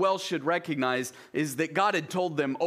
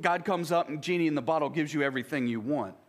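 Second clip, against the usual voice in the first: usual speaking voice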